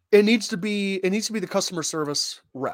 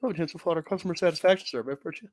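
rapid disclaimer read; 4 dB quieter, much faster than usual. Acted. Fast menu disclaimer.

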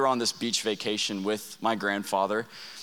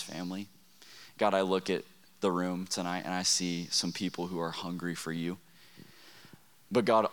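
spoken prayer; 2 semitones lower, 5 dB quieter than usual. Acted. Pastor prays over the student crowd, hushed and earnest. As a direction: pray quietly over the room.

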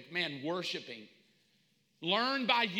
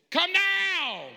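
high, loud, drawn-out shout; screamed command; 11 semitones higher, 7 dB louder than usual. Acted. Preacher reading the word as exclamation, screamed command. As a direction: yell it with an exclamation point.

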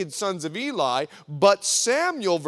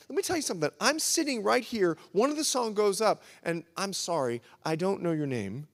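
ordinary delivery

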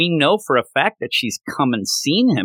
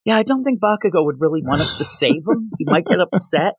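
put-on higher, timid girlish voice; hesitant young girl voice, pitched up; meek Uraraka impression; 5 semitones higher than usual. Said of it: "And she's like".

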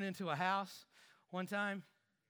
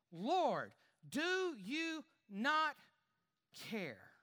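slow, higher, pleading question from Martha; drawn-out plea; 4 semitones higher, much slower than usual. Acted. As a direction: stretch it out as if begging. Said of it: "she approached him and said".

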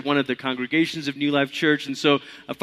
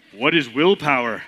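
lightly lowered Toad voice; a hint of a lower frog character; 3 semitones lower than usual. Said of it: "Toad has to have a lower voice".

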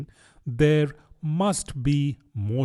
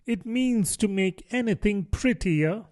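high-pitched, tentative explanation; doubtful; 10 semitones higher than usual. A narrator's reading. Alice explains beautify to the griffin, doubtfully. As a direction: offer the definition uncertainly, pitched high. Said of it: "said Alice doubtfully".

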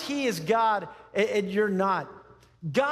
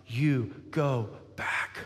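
low, slow, word by word; still small voice; 7 semitones lower, much slower than usual. Acted. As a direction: send him back gently and deliberately. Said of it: "show up in a still small voice".

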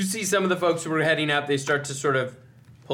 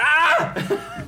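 shrill panicked yelp, much higher; high shriek; 5 dB louder than usual. Acted. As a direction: squeal of a man caught pantsless. GM screams as exposed NPC.

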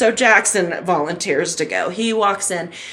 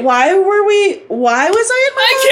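high-pitched confused questioning; exaggerated befuddlement; mock bewilderment; 10 semitones higher, 4 dB louder than usual. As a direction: puzzle it out in comic disbelief. Replaying her confused questioning.

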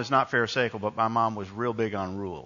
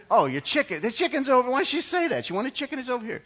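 high-pitched mock outburst; exasperated realisation; 13 semitones higher, faster than usual. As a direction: imitate a clerk suddenly getting it, voice shooting up high. Retold counterman finally understands, exasperated.

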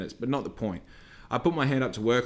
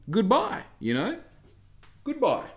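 dismissive goodbye; 11 semitones higher than usual. Retold or imitated. Dismissing the customer.

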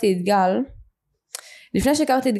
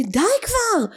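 retold outburst; 12 semitones higher, slower than usual. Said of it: "הוא התפרץ עליי ואמר לי".

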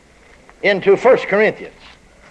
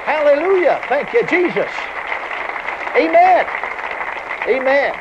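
ecstatic praise; 10 semitones higher, slower than usual. Real, not acted. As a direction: shout praise in rapture.

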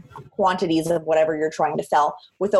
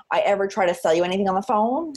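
ordinary talk

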